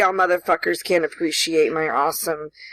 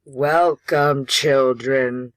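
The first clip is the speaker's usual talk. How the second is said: slow, lower horror-host voice; slow theatrical villain menace; 5 semitones lower, much slower than usual. Acted. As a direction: welcome them like a creepy villain. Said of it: "What in the hell is that supposed to be".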